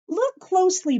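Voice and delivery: ordinary talk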